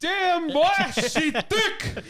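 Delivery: high, loud comic holler; hyped-up catcall. Pretend. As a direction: whoop it out in mock admiration. Hyped reaction to burger picture.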